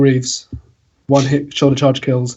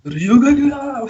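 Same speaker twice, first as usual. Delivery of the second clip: mock Japanese accent; 11 semitones higher, slower than usual. Mimicking Hanzo's Japanese ultimate line.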